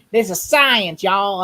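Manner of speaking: loud, high mock Southern accent; stupid Southern accent. Mocking an actor's terrible accent to a friend, hammed-up Southern accent. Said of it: "stupid ass Southern accent".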